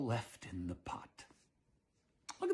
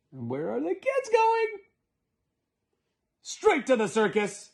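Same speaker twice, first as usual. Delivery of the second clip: excited; 11 semitones higher, 4 dB louder than usual. Real, not acted. Excited aside, kids escaping.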